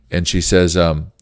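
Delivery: ordinary delivery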